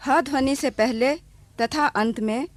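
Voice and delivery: usual speaking voice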